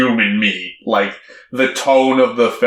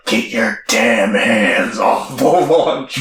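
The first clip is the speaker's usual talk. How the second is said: slow, drawn-out mock growl; aggressive dad; 2 semitones lower than usual. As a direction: imitate a menacing dad, stretching each word. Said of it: "really aggressive".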